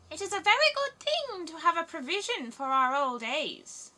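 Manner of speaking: high, loud croaking bird voice; talking ravens. Acted. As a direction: caw it out like an old pair of ravens. Ravens speak of their old age.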